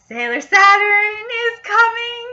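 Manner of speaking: gleeful, sung-out teaser; excited singsong announcement. Real, not acted. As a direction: sing it out like a big reveal. Excited teaser for next episode.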